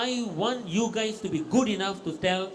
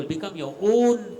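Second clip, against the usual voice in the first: "own" stressed, slow and louder; emphatic slow push; 5 dB louder, slower than usual. Real, not acted. Agency head rallying local suppliers, slow emphatic stress on "own". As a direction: hammer home "Become your own" word by word.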